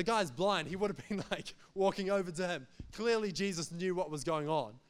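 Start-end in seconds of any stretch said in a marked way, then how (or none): none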